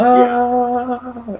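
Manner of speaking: long, high held scream; drawn-out high wail, imitating the film; mock freak-out scream. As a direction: imitate someone flipping out with a sustained "ah". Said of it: "he's just flipping".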